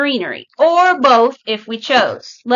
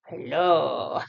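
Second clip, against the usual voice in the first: a deep, soft hello for the dog; low, quiet dog voice; 9 semitones lower, 10 dB quieter than usual. Pretend. Voicing the dog's hello.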